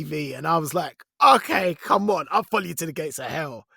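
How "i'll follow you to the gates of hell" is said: ordinary talk, nothing marked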